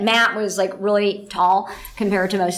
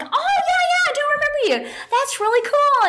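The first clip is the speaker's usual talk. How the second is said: high bright reply. Retold or imitated. Her reply to fan, very high.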